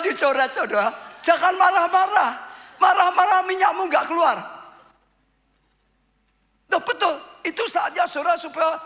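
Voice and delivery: high, loud preaching that climbs to a near shout; full-force preaching. Acted. Preacher warning the congregation not to rage, loud and high.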